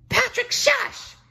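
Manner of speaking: very high slow hiss-shush. A narrator's reading. SpongeBob shushing Patrick, squeaky hush. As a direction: hush your friend. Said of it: "SpongeBob shushed him".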